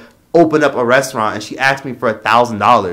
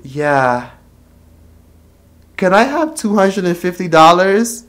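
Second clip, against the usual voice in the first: mom asking for money; 5 semitones higher, slower than usual. Retold or imitated. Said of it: "she would say".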